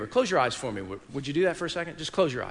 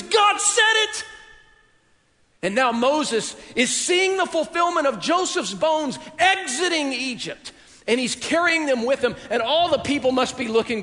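much higher and louder, shouting with a laugh in it; full-force preaching; 10 semitones higher, 6 dB louder than usual. Acted. Preacher to congregation, shouting Joseph's faith and the people's excitement. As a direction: belt out Joseph's faith like a triumphant cry.

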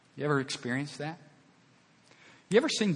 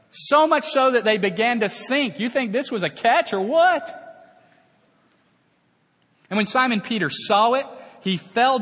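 higher, louder rising excitement; excited preaching; 9 semitones higher, 6 dB louder than usual. Real, not acted. Pastor asking the crowd about the huge catch, fired up. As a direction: stir the crowd up.

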